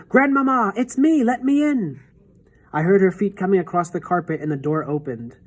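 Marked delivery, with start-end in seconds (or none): 0.1-2.0 a high, loud calling shout. A narrator's reading. Boy shouts to his grandmother through the door, loud. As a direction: call through the door with everything.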